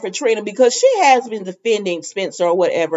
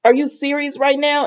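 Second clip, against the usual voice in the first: incredulous retort; 6 semitones higher than usual. Retold or imitated. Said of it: "I said".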